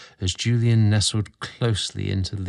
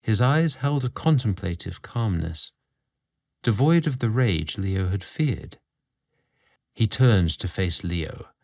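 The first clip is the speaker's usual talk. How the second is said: ordinary delivery, nothing marked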